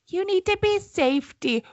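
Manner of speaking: a hint of a fussing mom; lightly voiced mom warning; motherly voice. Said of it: "you called me Mama [name]".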